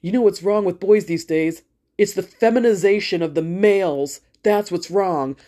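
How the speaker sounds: lightly voiced smug commenter; a hint of a preachy online guy; mildly mocking impression of a man's comment; mocking a know-it-all guy. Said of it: "some guy was like".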